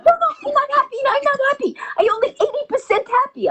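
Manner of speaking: put-on very high, protesting Japanese accent. Japanese passenger complaining to the pilot, very high accented voice. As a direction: squeal it as a caricatured Japanese woman. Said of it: "She's like".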